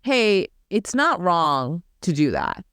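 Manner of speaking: normal talking voice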